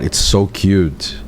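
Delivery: ordinary talk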